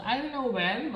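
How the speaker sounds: ordinary delivery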